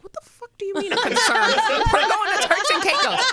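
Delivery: high, fast, incredulous and laughing; can't believe what she just heard, cackling; high laughing outrage at a silly concern; laughing disbelief. Retold or imitated. Group reacts in disbelief.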